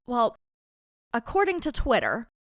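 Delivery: ordinary talk, nothing marked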